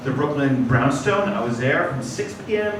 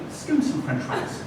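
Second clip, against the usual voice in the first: calm; 6 semitones higher, 7 dB quieter than usual. Retold or imitated. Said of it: "I calmly said".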